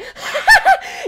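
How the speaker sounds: high manic cackle; cackling madly. Acted. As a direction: cackle like a gleeful maniac. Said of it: "cackling madly".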